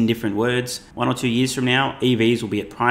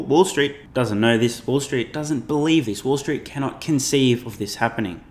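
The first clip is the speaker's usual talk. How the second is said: slow and emphatic. Real, not acted. Hammering the point.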